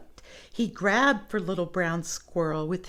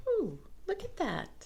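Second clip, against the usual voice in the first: soft marveling ooh; 9 semitones higher, 8 dB quieter than usual. Real, not acted. Marveling at picture.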